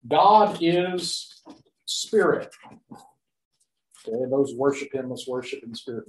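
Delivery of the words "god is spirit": emphatic quote. Shown as a demonstration. Quoting verse, emphatic.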